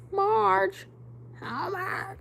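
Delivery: high, put-on character voice; cartoon-style call. Streamer riffing on the name Margie, goofy high impression. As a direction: pitch it way up into a silly voice. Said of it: "Let me never do that again".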